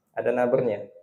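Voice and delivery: ordinary talk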